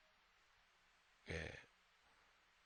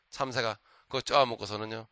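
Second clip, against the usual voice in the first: ordinary talk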